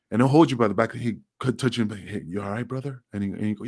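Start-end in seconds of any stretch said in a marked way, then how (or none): 2.0-3.0 softer, caring check-in; gentle concern. Retold or imitated. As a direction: ask softly if he's all right. Axel checking on him again.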